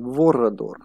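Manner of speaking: Vorador with rolled r and open o's, in the character's foreign accent; his accent. Shown as a demonstration. Gamer mimics the vampire character's name in his accent. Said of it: "I'm gonna say it in his accent".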